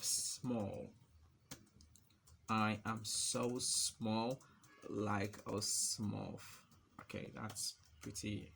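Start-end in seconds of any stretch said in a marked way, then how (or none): 2.5-6.4 very slow, drawn-out words; typing-pace dictation. Real, not acted. Typing small example text, very slow dictation. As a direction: stretch it out, pausing midway.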